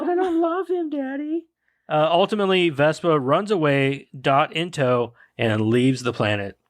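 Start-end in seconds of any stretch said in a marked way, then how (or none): 0.0-1.5 much higher, whiny daughter pleading; high princess voice. Retold or imitated. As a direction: plead with Daddy like a bride. Quoting Vespa's line.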